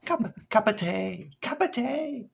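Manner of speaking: very high, squeaky mock Cockney; sing-out Cockney "tay", sky-high; hilarious Cockney imitation. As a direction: parody an actress's bad Cockney in a high pitch. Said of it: "she says it so hilariously".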